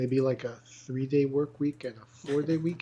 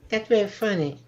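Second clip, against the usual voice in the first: higher-pitched boxer impression; 6 semitones higher than usual. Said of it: "My [name] and my [name]".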